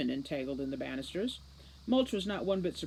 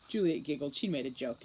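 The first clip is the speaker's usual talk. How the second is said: ordinary delivery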